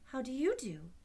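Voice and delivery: put-on polite girlish greeting; voiced girl's how do you do; polite Dorothy. A narrator's reading. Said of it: "replied Dorothy politely".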